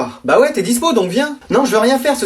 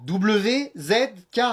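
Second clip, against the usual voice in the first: "W Z K" slowly, letters separated; patiently respell "W Z K", one by one; slow correcting dictation; 8 dB quieter, much slower than usual. Acted. Child correcting parent's typing, slow dictation. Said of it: "moins vite".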